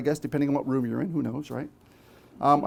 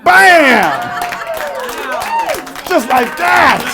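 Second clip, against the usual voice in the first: big triumphant reveal, loud and high; excited exclamation; 14 semitones higher, 8 dB louder, much slower than usual. Real, not acted. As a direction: punch it like a magic reveal. Trick revealed to the crowd.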